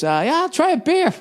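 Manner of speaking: high, far-off mock voice; mouth's own voice. His mouth answering the host for him, squeaky and high. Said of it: "My mouth just goes".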